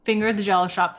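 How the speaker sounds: ordinary talk, nothing marked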